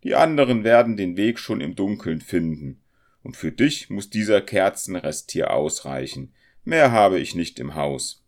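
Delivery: loud, surly dismissal; grudging landlady; grim. A narrator's reading. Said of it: "entgegnete sie finster".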